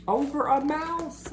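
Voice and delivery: high, soft amused aside; amused disbelief. Acted. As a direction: tease the elephant. Narrator mocking scared elephant.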